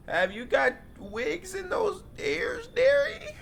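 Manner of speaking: put-on very high, slow old granny; mock the host like a cheeky granny; teasing granny. Granny teasing the deaf host, very high. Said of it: "My grandma voice kinda mid".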